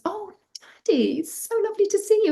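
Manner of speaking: high, fast squeal of greeting; excited daughter. A narrator's reading. Daughter greeting her father, excited. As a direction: rush the greeting in girlish high delight. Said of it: "they're really excited to see each other".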